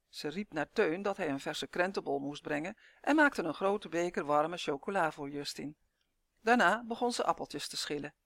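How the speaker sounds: plain reading voice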